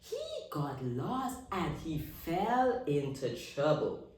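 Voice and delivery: usual speaking voice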